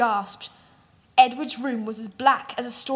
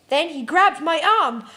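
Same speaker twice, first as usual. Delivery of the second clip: ordinary delivery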